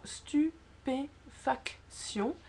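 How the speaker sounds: slow "stupéfaction" in separate syllables, stu, pé, fac, tion. Shown as a demonstration. Teacher repeating the spoken syllabation, syllable by syllable. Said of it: "c'est vraiment".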